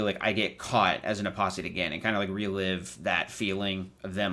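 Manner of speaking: normal talking voice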